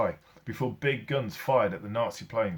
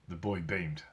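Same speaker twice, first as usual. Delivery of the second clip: ordinary delivery, nothing marked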